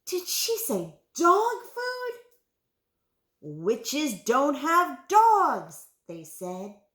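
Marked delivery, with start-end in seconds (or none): 0.1-2.2 high, loud incredulous outcry; indignant crowd demanding an answer; angry voices. A narrator's reading. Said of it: "lots of angry voices". 3.4-5.9 slow, loud, high indignation; angry voices. A narrator's reading. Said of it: "lots of angry voices".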